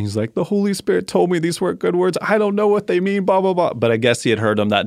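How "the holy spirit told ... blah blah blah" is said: higher, tearful-sounding kid voice, mildly acted; imitating a bawling child. Dad retells son crying over bad words, mock tearful kid voice. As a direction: play the boy sobbing out his confession, lightly. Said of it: "he started bawling".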